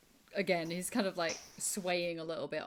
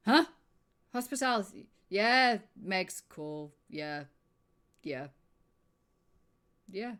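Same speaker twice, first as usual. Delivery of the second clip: put-on drunk guard; 3 semitones lower, 18 dB louder than usual.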